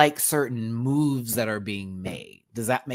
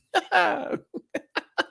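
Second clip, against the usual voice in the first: much higher laugh; high cackle. Real, not acted. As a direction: cackle at your own shadiness. Laughs at own shady plan.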